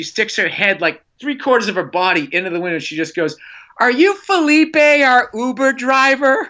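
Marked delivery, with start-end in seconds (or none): 3.8-6.5 put-on much higher, drawn-out drunken shout; mock tipsy yell, pitched way up, slowing at the end; hammered woman. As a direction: impersonate a drunk woman calling into a car. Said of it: "She's hammered".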